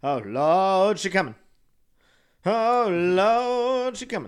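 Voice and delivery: loud, high mock alarm; mock panic. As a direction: cry out in hammed-up panic. Tornado warning alarm.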